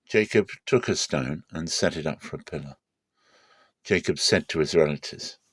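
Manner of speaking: plain reading voice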